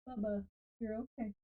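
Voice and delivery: soft, quick murmur to the dog; quiet aside to pet. Real, not acted. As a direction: hush the pet, barely audible. Soothing pet mid-recording.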